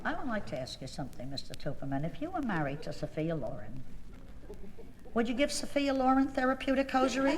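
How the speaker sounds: very quietly. Retold or imitated. Wife quietly confronting her husband at the restaurant. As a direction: quietly needle him by his full name. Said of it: "very quietly".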